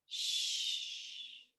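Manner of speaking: sh, a long unvoiced hiss through the teeth; sh sound. Shown as a demonstration. Teacher showing the sound sh. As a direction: demonstrate the sh, air through the teeth. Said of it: "the first syllable is this sound".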